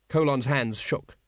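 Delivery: ordinary delivery, nothing marked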